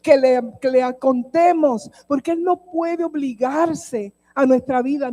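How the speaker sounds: loud, high, impassioned preaching; preaching at full force. Acted. Preacher driving her testimony home to the congregation, loud and high. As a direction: preach it to the whole church.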